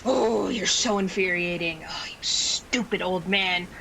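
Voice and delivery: whispered seething annoyance; breathy, unvoiced exasperation; exasperated whisper. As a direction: hiss it under your breath. Acted exasperation at old acquaintance.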